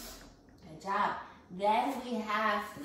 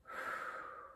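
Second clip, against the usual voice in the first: soft whistling blow through pursed lips; a quiet unvoiced fffoo; cold breath out; 9 dB quieter than usual. Shown as a demonstration. Teacher shows the kids a cool whistling breath, soft blow. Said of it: "that's gonna be a cold breath".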